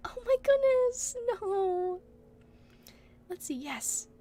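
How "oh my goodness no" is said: high startled oh my goodness; surprised gasp. Real, not acted. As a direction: react in shock.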